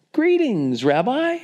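high-pitched, gushing hail; hammed-up joyful welcome; effusive greeting. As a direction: greet him with showy delight, pitch way up. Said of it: "why I say it like that".

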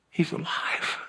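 whispered in hushed disbelief; awed whisper. Acted. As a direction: breathe it out, barely voiced. Mary realizing he lives.

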